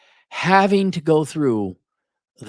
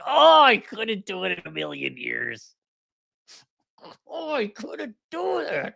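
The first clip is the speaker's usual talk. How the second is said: exasperated groaning; 8 semitones higher than usual. Retold or imitated. Man reacting to a tedious book, exasperated groan. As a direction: wail it, then fade higher and weaker.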